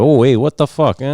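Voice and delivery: put-on Italian-Irish New York accent. Demonstrating the blended accent to friends, mock Italian-Irish New York accent. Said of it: "that's kind of the accent you get".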